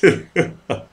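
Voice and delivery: short burst of laughter; brief amused laugh; real laugh. Real, not acted. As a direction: laugh at the compliment just read out. Laughs after reading a compliment.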